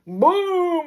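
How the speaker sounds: a high, sharp mock boom. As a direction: slam the word out like the wheels hitting. Imitating the hard landing.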